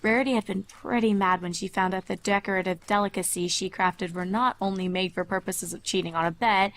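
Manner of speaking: usual speaking voice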